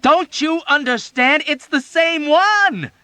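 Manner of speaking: high loud, very slow emphatic shout. A narrator's reading. Dunbar shouting above the noise to Hungry Joe. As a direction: shout it over the commotion. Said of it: "Dunbar shouted at him emphatically".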